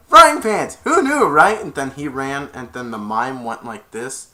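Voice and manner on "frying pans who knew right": loud, high amazed quip; hammed-up cheerful wonder; delighted. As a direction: marvel at the pan. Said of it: "and Eugene's like".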